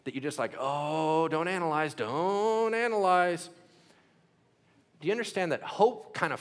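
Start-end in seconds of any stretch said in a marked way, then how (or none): 0.6-1.9 mock pleading. Mocking a believer's pleading. 1.9-3.5 "don't analyze" stretched slow and high, "don't" held long; slow right down and draw out "don't"; higher, slow mock whine on "don't analyze"; drawn-out plea. Second take drawn out.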